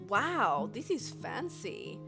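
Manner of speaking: normal talking voice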